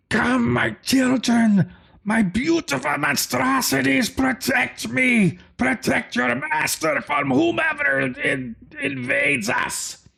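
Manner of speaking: high, slow, scratchy villain cry; nasally gargly scream. Acted. Master calling his monstrosities for protection, nasally scratchy screaming. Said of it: "nasally, gargly, kind of a scratchy voice screaming out".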